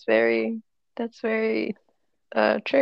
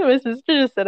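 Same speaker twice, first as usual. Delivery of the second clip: starts high, still laughing; amused, coming down from a laugh; 4 semitones higher, 6 dB louder than usual. Real, not acted. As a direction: report it through the tail of a laugh. Laughing at sister's remark.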